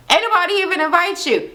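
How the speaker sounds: fast, high-pitched mock put-down; snide jab. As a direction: imitate someone snubbing a party crasher. Acted jab before drink is thrown.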